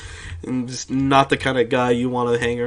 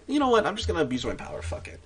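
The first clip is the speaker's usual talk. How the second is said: smug authority figure; 5 semitones higher, 4 dB quieter, faster than usual. Retold or imitated. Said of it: "they're just like".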